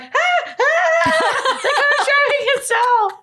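high, loud squeals through laughter; mock freak-out. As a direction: act out a giddy, laughing panic at a robot driver. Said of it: "And I was like, someone made a video, and I'm like".

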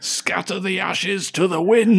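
slow, high, hammy proclamation; dramatic shout. Retold or imitated. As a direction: declaim it to the heavens. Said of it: "he shouts ridiculously dramatic".

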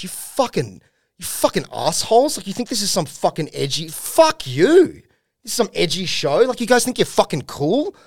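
high-pitched, wounded rant; upset, angry outburst. Retold or imitated. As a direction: lash out at the crowd, voice climbing high. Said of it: "he starts crying".

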